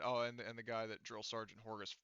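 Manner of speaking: usual speaking voice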